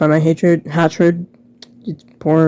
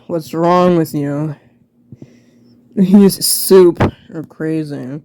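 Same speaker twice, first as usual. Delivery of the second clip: normal talking voice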